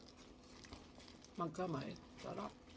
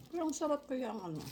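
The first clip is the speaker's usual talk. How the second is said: fast, higher talk through a mouthful; talking quickly between bites; chewing while talking; 6 semitones higher, 4 dB louder, faster than usual. Real, not acted. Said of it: "Kailangan nating magsalita". (nagsasalita habang ngumunguya)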